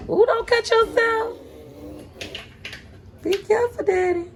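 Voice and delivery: wife fretting high at husband holding the knife; high anxious fuss. Real, not acted. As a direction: fret over him in a high voice. Wife fussing while husband cuts.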